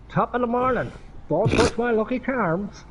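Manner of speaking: lightly hammed Irish accent as the cereal leprechaun; mock leprechaun. Leprechaun mascot voice, "leprechaun.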